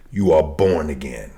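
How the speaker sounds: ordinary delivery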